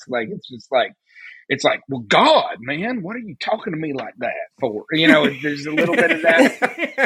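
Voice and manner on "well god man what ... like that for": Southern whine, mildly put-upon; whiny Tennessee accent. Shown as a demonstration. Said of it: "Tennessee accents sound like Southern whininess".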